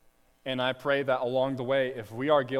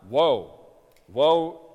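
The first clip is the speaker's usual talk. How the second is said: loud cry of Woe; 7 dB louder than usual. Retold or imitated. Preacher voicing afflicted nations, loud crying out. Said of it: "They're crying out".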